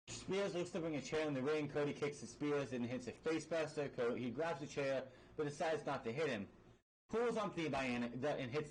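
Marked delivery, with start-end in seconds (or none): none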